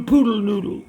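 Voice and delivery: ordinary talk, nothing marked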